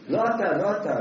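usual speaking voice

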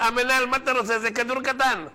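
high, lightly voiced whiny excuse; defender's excuse. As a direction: shrug it off to the coach. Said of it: "הוא אומר".

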